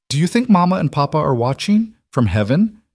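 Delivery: lightly voiced little girl, hopeful; a hint of a shy child asking; young girl voice. A narrator's reading. Child Juniper asks about parents.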